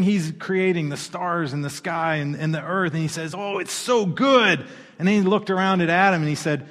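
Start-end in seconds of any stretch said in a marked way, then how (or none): 3.4-4.6 God's voice. Acted. God voiced in creation story.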